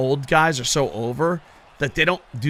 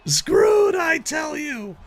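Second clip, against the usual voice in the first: high hammed-up yell, slowing at the end; mock dramatic shout; 12 semitones higher, much slower than usual. Gamer joking the TV title is doomed, hammed-up high yell. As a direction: ham up the doom like a carnival barker.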